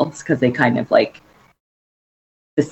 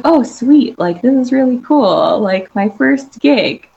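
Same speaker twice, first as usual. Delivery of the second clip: excited; 9 semitones higher, 4 dB louder than usual. Retold or imitated. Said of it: "I was like".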